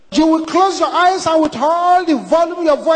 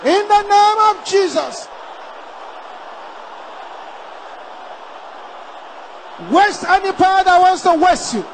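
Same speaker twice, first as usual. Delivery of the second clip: full-force shouted sermon lines; fiery preaching; 6 semitones higher, slower than usual. Acted. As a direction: preach it hard at the crowd.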